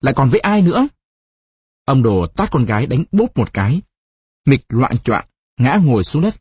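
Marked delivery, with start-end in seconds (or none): 0.0-0.9 higher, testy retort; exasperated comeback, lightly acted; irritable cutting-in. A narrator's reading. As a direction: snap back with a rising question. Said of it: "Mịch cáu kỉnh cắt".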